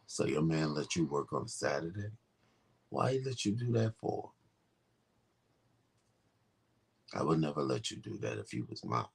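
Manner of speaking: low, quiet come-on; deep flirting voice. Acted. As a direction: murmur it deep to woo a customer. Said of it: "if it's a woman".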